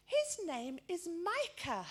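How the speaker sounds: put-on slow, careful toddler voice; little boy answering. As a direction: say it like a proud small child.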